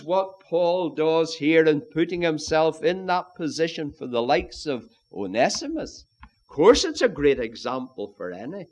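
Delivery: ordinary talk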